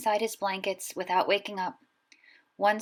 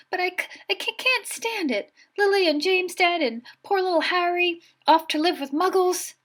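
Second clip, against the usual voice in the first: much higher, stammering through tears; a big man crying over the orphan; sobbing giant; 10 semitones higher, 4 dB louder than usual. A narrator's reading. Hagrid grieves for Harry, much higher and tearful. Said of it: "sobbed Hagrid".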